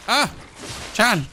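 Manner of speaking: high, loud startled yell at a fish. Real, not acted. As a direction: jump at the sudden fish.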